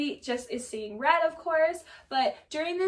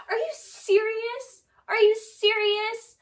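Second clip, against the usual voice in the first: high, drawn-out disbelief, acted out; mock incredulous; 9 semitones higher, 5 dB louder, slower than usual. Raquel challenging Schwartz for checking on Katie, mock incredulous. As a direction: imitate someone aghast, pitched well up. Acted indignant retort in retelling.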